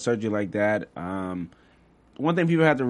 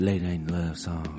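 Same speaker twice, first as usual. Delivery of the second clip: chill voice; 7 semitones lower, much slower than usual. Pretend. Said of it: "It's just like a chill voice".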